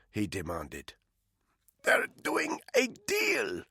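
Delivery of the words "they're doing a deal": slow, high, whispered croak through a tight throat. Acted. Woman half strangled by a rope, croaking slowly in a whisper. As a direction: squeeze the words out past a rope. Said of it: "she croaked".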